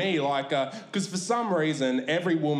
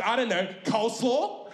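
unsure server; 8 semitones higher than usual. Retold or imitated. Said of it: "She goes".

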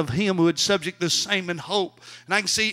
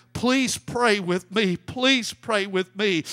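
ordinary delivery

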